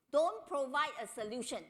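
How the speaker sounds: ordinary talk, nothing marked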